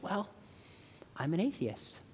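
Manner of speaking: softly imitated shy confession; hesitate, then admit it quietly; sheepish. Girl privately confessing to the visiting speaker, retold softly and sheepishly. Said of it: "sheepishly goes".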